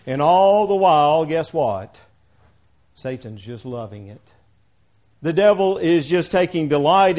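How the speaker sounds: loud, driving preacher's reading; fiery scripture reading. Acted. Preacher reading Corinthians to the congregation, booming. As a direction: hammer home every "one".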